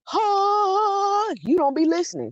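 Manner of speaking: high, drawn-out mock gasp of realization; hammed-up sudden self-discovery; retold epiphany. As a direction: act out a light bulb going on. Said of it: "It was like an epiphany".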